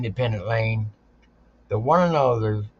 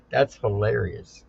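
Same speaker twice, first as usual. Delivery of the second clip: ordinary delivery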